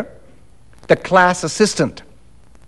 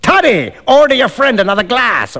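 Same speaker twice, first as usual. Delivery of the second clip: high-pitched, peremptory command; imperious rogue calling out; bossy order; 7 semitones higher than usual. Acted. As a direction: order him about like a lackey. Stranger issuing orders to Macfarlane.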